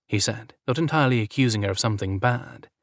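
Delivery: plain reading voice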